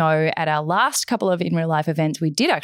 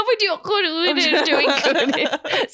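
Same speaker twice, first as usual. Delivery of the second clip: laughing imitation; 12 semitones higher, much slower than usual. Real, not acted. Mocking partner's garbled line.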